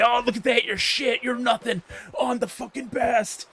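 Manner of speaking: high, sneering bully voice; mocking a cocky gamer. Retelling the bragging arcade rival, high sneer. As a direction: mimic the gloating kid mockingly.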